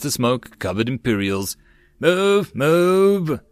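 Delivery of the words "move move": high, loud "Move! Move!"; yelling. A narrator's reading. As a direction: drive the troops forward. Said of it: "Terrier yelled".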